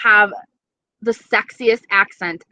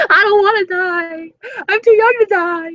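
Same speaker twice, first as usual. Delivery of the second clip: mock wailing; 10 semitones higher, 4 dB louder than usual. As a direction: wail melodramatically like you're pleading for your life. Mock wailing at a joking threat.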